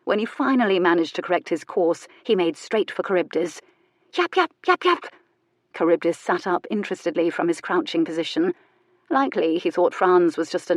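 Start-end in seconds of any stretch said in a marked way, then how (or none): 4.1-5.1 high yapping barks; tiny dog rushing the cat, high yaps; yappy little dog. A narrator's reading. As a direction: yap like a lapdog.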